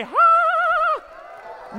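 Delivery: high, loud, sustained breathy call; magic-spell "ha". Shown as a demonstration. Magician leads the audience's spell on Mark, high and loud. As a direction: cast a spell with a long "haaa".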